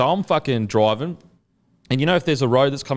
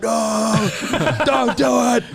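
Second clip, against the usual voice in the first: much higher, laughing mock panic "don't do it"; brother on the phone; 12 semitones higher, much slower than usual. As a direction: voice the panicked brother through giggles. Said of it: "So he's like".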